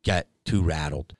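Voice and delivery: ordinary talk, nothing marked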